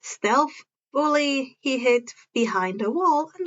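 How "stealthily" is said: usual speaking voice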